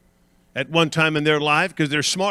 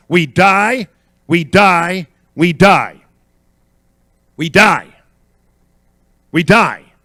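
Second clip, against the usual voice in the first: loud, measured pulpit refrain; punchy preacher's declaration; emphatic repetition; 3 semitones higher, 8 dB louder, slower than usual. Real, not acted. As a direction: drum it in, pausing between each.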